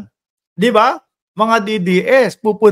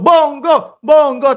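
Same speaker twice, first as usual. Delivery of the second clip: mock chant-shout, raised pitch; imitated shouting; 6 semitones higher, slower than usual. Vlogger imitating a supporter shouting Bongo's name, mock shouting. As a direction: call the name out like a rally crowd. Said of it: "Habang sumisigaw".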